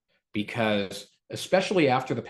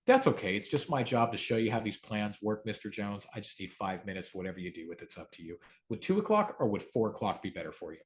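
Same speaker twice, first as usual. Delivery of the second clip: voice going down; 5 semitones lower, 7 dB quieter than usual. Shown as a demonstration. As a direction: lower and softer, calm rebuttal. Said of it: "I kinda make my voice go down a little bit".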